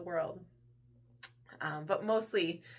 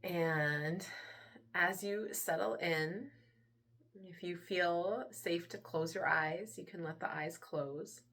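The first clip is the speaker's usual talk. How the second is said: soft, unhurried meditation voice with long pauses; guided meditation; 2 semitones lower, 7 dB quieter than usual. Acted. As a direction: hush down and gently lead them into relaxation. Leads a guided relaxation.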